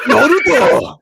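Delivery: huge, drawn-out mock-Japanese shout; hammed-up high anime battle cry; anime-title yell. Shown as a demonstration. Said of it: "yell anime titles".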